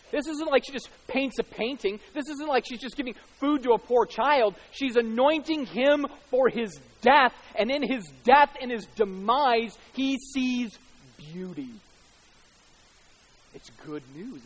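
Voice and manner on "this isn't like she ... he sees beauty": high, loud pulpit proclamation, slowing on the weighty phrases; emphatic, ringing sermon climax; impassioned preaching. Real, not acted. Preaches at full force.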